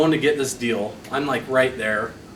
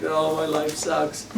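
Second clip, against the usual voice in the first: mopey self-pity; 5 dB quieter, slower than usual. Retold or imitated. Napping in car after failing.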